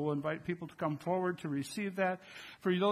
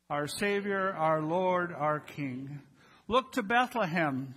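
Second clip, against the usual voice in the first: louder, rising proclamation read with solemn weight; liturgical reading; 5 dB louder, slower than usual. Acted. Pastor leading the congregation in the Christmas litany, solemn proclamation.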